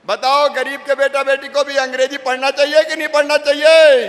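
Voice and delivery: loud, high question hurled at the crowd; rallying shout. Real, not acted. Leader asking villagers if poor children deserve English, rallying shout. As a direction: shout it out, demanding an answer.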